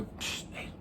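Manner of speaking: hushed whispered call. Retold or imitated. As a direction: psst it under your breath. People whispering from another table.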